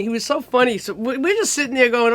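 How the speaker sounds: ordinary delivery, nothing marked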